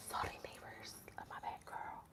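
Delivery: hushed, barely audible whisper; whispered apology. Real, not acted. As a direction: whisper sorry under your breath. Private apology to neighbors.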